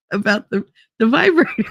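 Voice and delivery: normal talking voice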